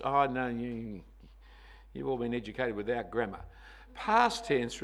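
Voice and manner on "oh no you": ordinary talk, nothing marked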